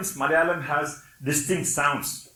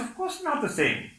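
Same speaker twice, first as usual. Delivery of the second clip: higher-pitched mock protest; quoted insistence; 7 semitones higher than usual. Malayalam speakers answering whether two sounds match, insistent. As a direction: imitate speakers insisting, voice lifted. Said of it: "they say".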